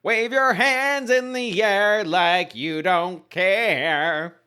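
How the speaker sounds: slow, high, sung-out line. Shown as a demonstration. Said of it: "it's so hard not to read this next part without".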